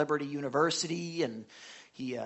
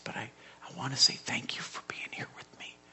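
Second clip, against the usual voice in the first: voiceless, feeble thanks; Mike's weak whisper; 3 semitones lower, 4 dB quieter than usual. Retold or imitated. Dying friend thanks the pastor for being here, weak whisper. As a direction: whisper it gratefully from the sickbed. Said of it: "he talks like this".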